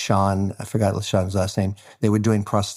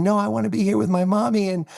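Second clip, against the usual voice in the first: put-on high, fast little boy voice; a kid who won't leave his mommy, higher; boy refusing to leave the trailer, high quick child voice; protesting child; 10 semitones higher than usual. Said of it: "not saying".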